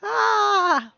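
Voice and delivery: sudden high loud theatrical "Ah!"; dramatic cry. Pretend. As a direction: burst out with a hammy magician's cry. Dramatic magic door incantation.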